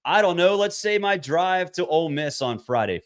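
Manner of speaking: ordinary talk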